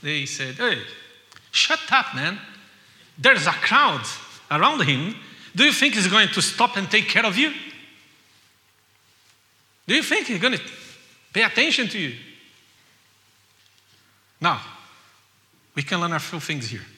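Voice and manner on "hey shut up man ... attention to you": loud, scolding crowd voice; annoyed crowd. Retold or imitated. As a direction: tell the beggar off. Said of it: "people get annoyed with the guy".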